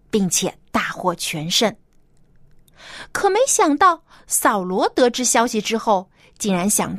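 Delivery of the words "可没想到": ordinary delivery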